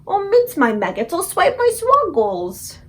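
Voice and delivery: high, loud, dragged-out giant oath; the BFG cursing the bad dream he caught; distressed exclamation. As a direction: wail the made-up curse slowly. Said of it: "he cried".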